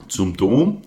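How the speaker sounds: loud bleating disbelief; grumbling Captain. A narrator's reading. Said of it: "meckerte Captain".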